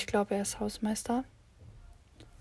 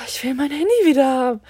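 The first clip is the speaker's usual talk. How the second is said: fast, louder, higher desperate whine; inner whine; 3 semitones higher, 7 dB louder, faster than usual. Retold or imitated. Student silently pleading to get her phone back, fast and loud. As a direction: blurt it out near tears. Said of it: "Ich dachte mir nur so".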